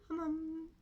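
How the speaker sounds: ordinary delivery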